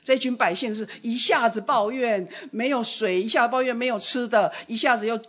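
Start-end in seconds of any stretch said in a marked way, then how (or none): none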